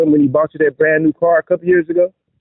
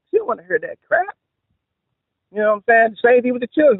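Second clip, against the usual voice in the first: ordinary delivery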